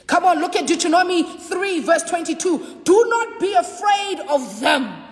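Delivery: rising, ringing sermon climax; full-force preaching. Real, not acted. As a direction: preach it out to the congregation.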